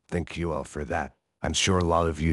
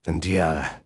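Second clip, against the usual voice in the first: ordinary talk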